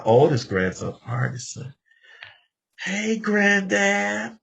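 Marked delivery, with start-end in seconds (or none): 2.8-4.4 put-on high, slow, stretched child's voice; child's greeting. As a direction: call out like a happy little grandson. Grandson greeting him, voiced high.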